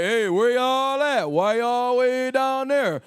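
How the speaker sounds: drawn-out high holler down a hallway; yelling. Acted. Calling to friends at the end of a long hall, yelling. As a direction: call out slowly to friends far off. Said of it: "Why you yelling?".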